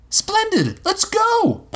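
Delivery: very high, eager exclamation; boy charging off to find a pirate; excited boy. A narrator's reading. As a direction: leap into it like a thrilled kid. Will rushes off eagerly.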